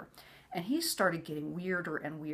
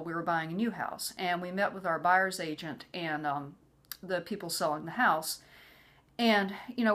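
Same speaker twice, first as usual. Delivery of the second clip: usual speaking voice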